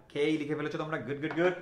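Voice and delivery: ordinary talk, nothing marked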